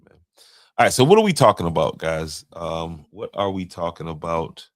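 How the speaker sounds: ordinary delivery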